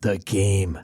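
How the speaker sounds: deep, dry, measured radio announcer; public radio host. Acted. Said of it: "that's my, uh, public radio voice".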